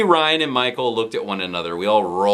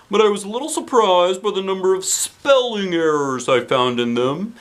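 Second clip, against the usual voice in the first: principal voice; 8 semitones higher, 3 dB louder than usual. Acted. Principal praises the class in a hammy voice. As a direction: boom it out like a cheery principal.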